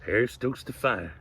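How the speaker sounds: plain reading voice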